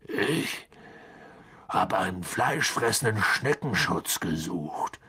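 low gruff rumble; Hagrid growling. A narrator's reading. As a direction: grumble it in a low giant's voice. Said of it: "brummte Hagrid".

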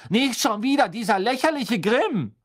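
exasperated, loud and high; loud outburst. Acted. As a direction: burst out, fed up. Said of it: "sagte Hermine laut".

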